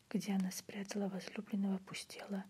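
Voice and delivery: ordinary delivery, nothing marked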